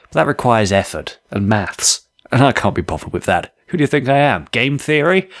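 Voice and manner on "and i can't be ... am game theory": usual speaking voice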